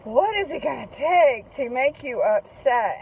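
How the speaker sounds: mock exasperated outburst, drawn out slowly; half-yelled retold frustration, slow and swinging; retold exasperated yelling. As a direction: imitate a frustrated kid venting, stretching the words. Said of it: "screaming and yelling at me ... he goes".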